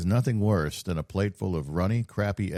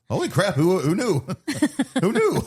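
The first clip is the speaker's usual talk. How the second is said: surprised; 11 semitones higher, 6 dB louder than usual. Retold or imitated. His own retold amazed reply.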